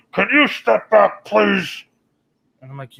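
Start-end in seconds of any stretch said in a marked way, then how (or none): 0.1-1.9 stern request. Retold or imitated. Hazmat man repeating order.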